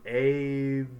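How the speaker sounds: "abandoned" slowly as "AY... ban... don... ned"; mistaken sounding out. Shown as a demonstration. Teacher decoding a new word, mistaken slow syllables. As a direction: sound out "abandoned" letter by letter, long A. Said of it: "does not sound like a word".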